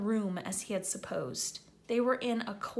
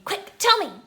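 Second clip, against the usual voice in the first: Filch, urgent; 11 semitones higher, 11 dB louder than usual. Acted.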